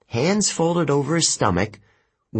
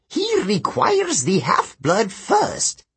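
high, slow demon voice; muttering demon; 9 semitones higher, slower than usual. Acted. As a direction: correct the fool in a drawn-out creature voice. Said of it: "the other Telekine muttered".

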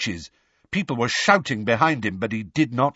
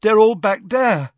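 put-on high, louder boyish character voice; Neville's urgent report; 9 semitones higher, 5 dB louder than usual. A narrator's reading. Neville answering Lupin about the others, high-voiced. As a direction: pipe up loudly with the news.

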